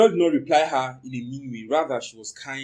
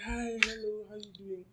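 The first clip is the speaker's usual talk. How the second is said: high, soft greeting; a touch of a sweet girl's hello; lightly voiced girl; 8 semitones higher, 12 dB quieter than usual. The girl greeting the narrator at the market, softly high.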